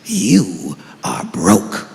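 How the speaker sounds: very low, slow, solemn father; deep slow dad correcting his son. Retold or imitated. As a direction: lecture your son gravely and low.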